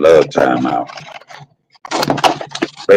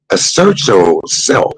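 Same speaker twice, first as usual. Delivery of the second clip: ordinary talk, nothing marked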